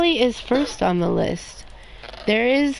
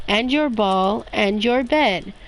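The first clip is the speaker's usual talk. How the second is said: ordinary delivery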